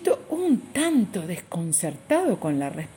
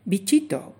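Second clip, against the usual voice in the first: usual speaking voice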